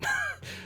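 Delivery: short high burst of disbelieving laughter; incredulous laugh. Real, not acted. Laughs at opponent's claim.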